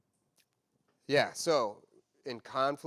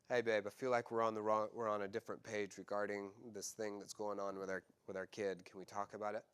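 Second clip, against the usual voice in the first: calm request; 6 semitones lower, 5 dB quieter than usual. Retold or imitated. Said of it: "Oh, that's great".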